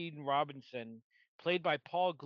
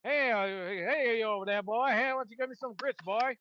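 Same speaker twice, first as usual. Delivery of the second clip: Southern accent; 6 semitones higher, 3 dB louder than usual. Shown as a demonstration. Host demonstrates a Southern accent on request, hammed-up Southern voice. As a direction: put on a cartoonish Southern accent, pitched up. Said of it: "let's hear the Southern accent".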